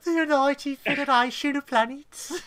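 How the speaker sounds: hammed-up Birmingham grandeur; Birmingham accent. Mocking a Brummie Federation, a high, loud Birmingham accent. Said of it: "who are just from Birmingham".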